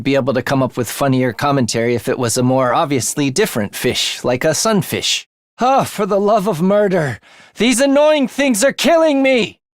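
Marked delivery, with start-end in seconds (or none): 5.6-9.6 frustrated outburst. Acted. Fighter fed up with enemies, exasperated yell. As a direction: blow up at the pests.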